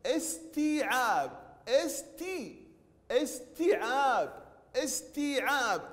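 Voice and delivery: استيعاب slowly, with tii held long; saturated long ii. Shown as a demonstration. Teacher modelling the word for pupils, long vowels. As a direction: stretch tii and aab in استيعاب. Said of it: "نعيد نطق التاء ونشبع التاء بالكسرة".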